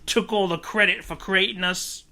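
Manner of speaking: mock-British rocker telling his story; British [name]. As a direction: keep up a hammed-up British voice, pitched high. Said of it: "British [name]".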